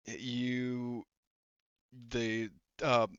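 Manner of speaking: usual speaking voice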